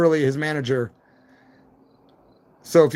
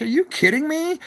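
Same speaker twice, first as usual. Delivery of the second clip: mock disbelief; 11 semitones higher than usual. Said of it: "I'm like".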